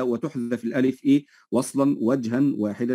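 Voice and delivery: normal talking voice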